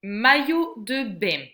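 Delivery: carefully articulated word list, spaced out; teacher reading picture words aloud; slow, loud word reading. Shown as a demonstration. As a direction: read each word loud and clear for a child. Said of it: "reading each word aloud clearly".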